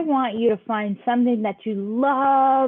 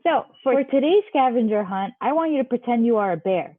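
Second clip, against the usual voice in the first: ordinary talk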